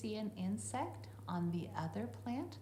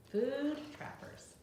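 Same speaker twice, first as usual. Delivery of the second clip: title read aloud; 8 semitones higher, 4 dB louder, slower than usual. Shown as a demonstration. Reads the book title aloud.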